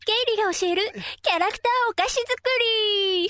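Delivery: high-energy cutesy announcer; bubbly anime-girl intro. Pretend. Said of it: "テンション高いですね".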